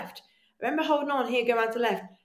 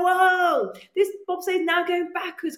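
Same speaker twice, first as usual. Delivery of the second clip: alarmed whoa; 8 semitones higher, 5 dB louder, slower than usual. Retold or imitated. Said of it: "I was like".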